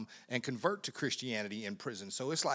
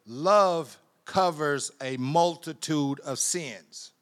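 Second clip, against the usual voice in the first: scripture reading; 2 semitones higher, 7 dB louder than usual. Acted. Reads scripture emphatically.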